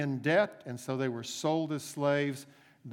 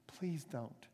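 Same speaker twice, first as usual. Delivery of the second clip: the plea drops much softer, almost breaking off; softer begging; 9 dB quieter than usual. Acted. Servant begging the master again, softer and fading. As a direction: let "Please don't." fade to a soft, desperate plea. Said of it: "he begged the master".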